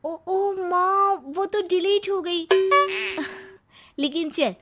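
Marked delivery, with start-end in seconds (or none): 0.0-2.5 high, slow, drawn-out excuse; feigned oops. Acted. Daughter pretending to mother the photo got deleted, feigning regret. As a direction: stretch it out in fake dismay.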